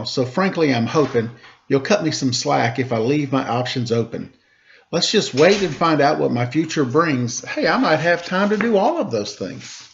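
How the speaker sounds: lively rhymed storybook reading; reading a story to children. A narrator's reading. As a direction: read the rhyming story aloud to the kids. Reads a rhymed picture book aloud.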